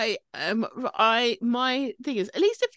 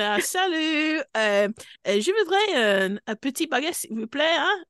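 mock French accent; 7 semitones higher than usual. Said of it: "I went".

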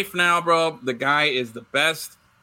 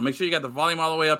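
usual speaking voice

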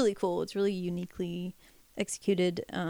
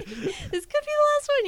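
said through laughter, much higher; giggling mock doom; laughing; 4 dB louder than usual. Real, not acted.

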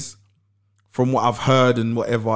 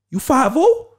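high-pitched, louder suspicious question; American brother; 11 semitones higher, 5 dB louder, slower than usual. Retold or imitated. American stranger answering a request for weed, high-pitched suspicion. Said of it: "the brother said to me".

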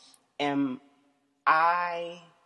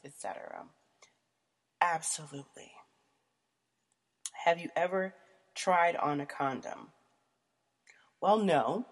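usual speaking voice

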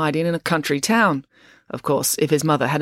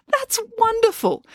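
much higher, slower, delighted; beaming; slower than usual. Acted. Loretta, thrilled to join the family, beaming at Joe. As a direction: glow with sudden joy. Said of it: "Loretta beamed".

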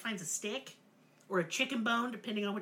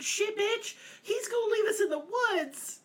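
put-on high panicked kid voice, a little louder; panicked Hansel; 12 semitones higher, 4 dB louder than usual. Hansel whispering to his sister about being left in the woods, high and panicked. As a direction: squeal it like a scared little boy.

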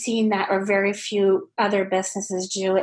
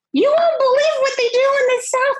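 high, fast, breathless disbelief; excited retelling; 5 dB louder, faster than usual. Retold or imitated. Telling Canadian friends on the phone about the South, high excited disbelief. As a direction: pitch it up like you can't believe it yourself. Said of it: "and I'm like".

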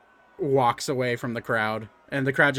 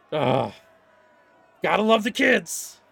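amused fighter voice; 4 semitones higher than usual. Acted. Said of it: "He's like".

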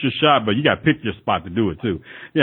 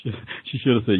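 ordinary talk, nothing marked

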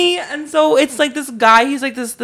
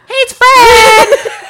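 put-on high, loud squeaky kid shout; Fred voice; 10 dB louder than usual.

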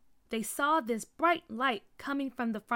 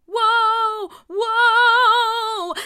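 high, loud, drawn-out whoa; alarmed yell; 11 semitones higher, 15 dB louder than usual. A narrator's reading. Kids being sucked into the box, alarmed yell. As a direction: cry out as the ground gives way.

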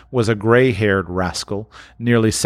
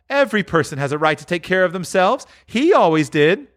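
high, fast and bold; brazen woman; 9 semitones higher than usual. Acted. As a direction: justify the theft, hard. Woman defiant.

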